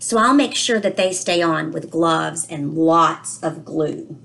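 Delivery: put-on a child's worried storybook voice, read aloud gently; reading to children. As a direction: read it to the class like a puzzled little boy. Reads book aloud, child narrator.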